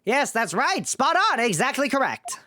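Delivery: put-on very high, smug old professor; very old man. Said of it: "the old man voice".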